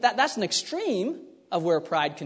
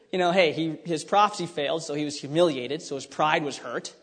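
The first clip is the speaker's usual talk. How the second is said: usual speaking voice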